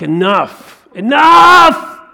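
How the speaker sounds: enough, building to a huge high yell; exasperated shout. Retold or imitated. Samuel silencing Saul with a furious high shout. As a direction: cut him off with a shout.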